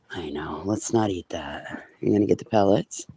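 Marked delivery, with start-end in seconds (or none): 0.1-1.8 hushed soft whisper to the animal. Real, not acted. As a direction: whisper it gently, slowly. Soothing animal quietly.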